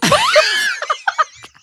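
laughing mock shout of a stunned boyfriend; shocked boyfriend. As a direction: squeal it out as the startled boyfriend, laughing. Boyfriend's shocked reaction retold.

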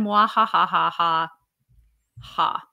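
slow evil villain cackle; drawn-out evil laugh; mad scientist. Acted. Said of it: "James Corden says to you".

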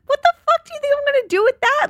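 very high, fast, exasperated squeal; incredulous outburst. Retold or imitated. Wife to husband offering a table napkin, high-pitched incredulous outrage.

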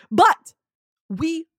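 rally the crowd, building up; rousing speech. Pretend. Mock leader rallying speech.

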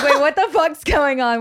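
playful bewildered outburst; imitated alarmed viewers. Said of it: "You're like".

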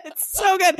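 high laughing burst, then gushing. Real, not acted. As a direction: crack up, still laughing through the words. Mom laughing at son's phrase.

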